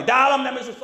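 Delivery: high exorcism command; forceful rebuke. Retold or imitated. Preacher reenacting the attack on a pretending demon, forceful rebuke. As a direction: hit the spirit with a high-pitched command. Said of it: "saya hajar terus".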